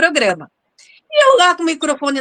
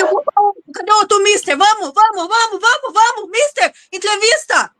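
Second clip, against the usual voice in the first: flustered rush; 7 semitones higher than usual. Retold or imitated. Hurrying on to the next interview.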